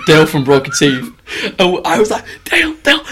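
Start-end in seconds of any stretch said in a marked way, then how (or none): 2.4-3.0 calling out. Retold or imitated. Waking mate who is sleeping.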